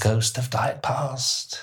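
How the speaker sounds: slow, high spooky ghost voice; drawn-out eerie haunting tone. Pretend. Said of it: "That's my ghost voice".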